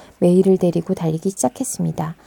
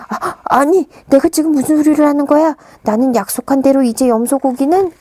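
louder, flustered backpedal; 6 semitones higher, 6 dB louder than usual. Acted. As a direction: fumble to take it back. Wolf flustered, catching himself. (허둥지둥)

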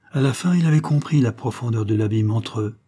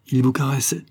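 pointed, higher-pitched question; brutal; 4 semitones higher than usual. A narrator's reading. Husband forcing his presence on his wife, brutal. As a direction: ask it bluntly, to wound. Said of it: "demanda le mari brutalement".

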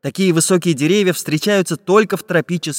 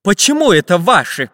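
raised, loud indignant question; menacing challenge; 5 semitones higher, 4 dB louder than usual. A narrator's reading. Samodelkin disputing the pirates' claim, menacingly. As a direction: challenge their claim sternly. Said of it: "грозным голосом закричал Самоделкин".

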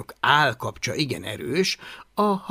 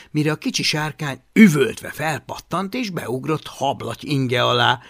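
ordinary delivery

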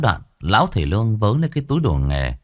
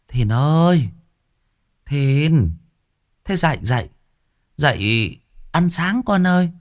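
higher calling voice of the mother; mother waking her son; 6 semitones higher than usual. A narrator's reading. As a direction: gently shake the boy awake, calling higher. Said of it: "Mụ tiến lại lay lay". (giọng mẹ gọi con dậy, cao hơn)

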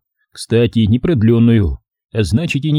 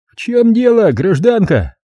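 raised-pitch official query; officious policeman; 11 semitones higher than usual. Acted. Plainclothes policeman questions angry mother, raised officious voice. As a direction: step in as the authority.

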